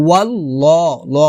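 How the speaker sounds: "ওয়াল্লাহ" as wallaw, with a dark rounded vowel; heavy rounded L. Shown as a demonstration. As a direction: round the second syllable of "ওয়াল্লাহ" to "law". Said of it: "বলতে হবে".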